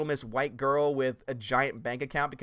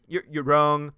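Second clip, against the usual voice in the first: retold retort; 7 dB louder, slower than usual.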